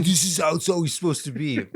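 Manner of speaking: high, loud, mock-fervent fanboy; edgelord Marvel fan. Joining in as the approving Marvel fans, mock fervent. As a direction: insist on it like a zealous fan. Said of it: "all the edgelord Marvel fans would think".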